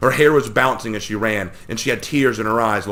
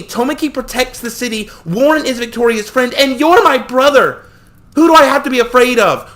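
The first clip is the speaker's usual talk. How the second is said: put-on distraught girl; 8 dB louder than usual. A narrator's reading. Sister pleads with her brother, distraught girl. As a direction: plead with him near tears.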